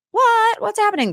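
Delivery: high-pitched imitation of a stunned reaction; exaggerated, high "huh?" of disbelief; mock surprise. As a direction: act out everyone's bewildered reaction. Quoting everyone's reaction to slip-up.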